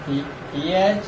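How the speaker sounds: "टीएच" said with air streaming out; th sound demo. Shown as a demonstration. Teacher shows students how "टीएच" sounds with air. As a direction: breathe the "टीएच" out through the teeth. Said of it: "आपने sound इसका देना है ऐसे".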